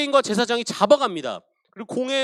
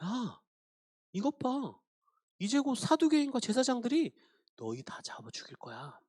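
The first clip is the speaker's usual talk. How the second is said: a hint of a softer, sinister devil; lightly voiced devil taunting quietly; devil's taunting voice; 7 dB quieter than usual. Devil taunting the frightened church, lightly voiced quiet menace. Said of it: "이렇게 말했을 거예요".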